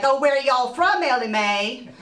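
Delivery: teasing, lifted Southern twang; mock a Southerner's folksy question; Southern accent. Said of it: "scrape out my Southern accent".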